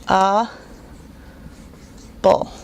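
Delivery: "a" and "bull" as isolated syllables; a, then bull, each standing alone; broken into syllables. Shown as a demonstration. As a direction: sound out a ... bull, long pause between. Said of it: "The word syllable has three syllables".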